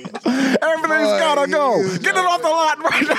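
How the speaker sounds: high, hyped-up car lot pitch; used car salesman. Pretend. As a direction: bark it like a slick salesman.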